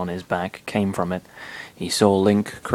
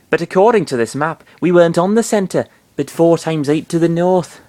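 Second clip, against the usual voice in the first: put-on Yorkshire accent; 8 semitones higher, 5 dB louder than usual. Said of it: "Is that Welsh".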